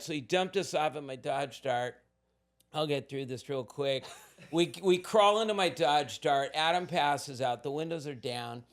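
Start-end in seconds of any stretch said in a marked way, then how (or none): none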